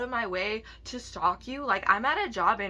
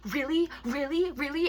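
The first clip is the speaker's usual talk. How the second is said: higher-pitched, rising disbelief; mock incredulity, pitched up; incredulous retold questioning; 5 semitones higher than usual. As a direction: imitate a brother's pointed disbelief, each word a rising question. Brother confronts her in retold scene.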